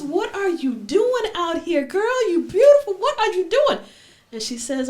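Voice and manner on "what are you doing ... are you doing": much higher, louder wooing call; flirting stranger.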